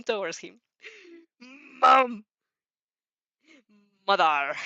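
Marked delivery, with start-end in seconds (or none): 1.4-2.2 yelling. Acted. 4.1-4.6 yelling. Acted.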